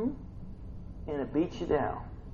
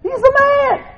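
high, drawn-out, excited cheer; acting out awed angels; 7 dB louder, slower than usual. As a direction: holler it like an adoring fan. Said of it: "And they're like".

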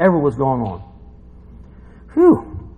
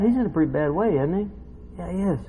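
usual speaking voice

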